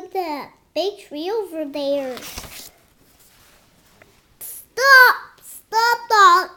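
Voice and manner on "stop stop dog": high, loud shout of stop. Acted. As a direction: yell it like a command. Child shouting story command.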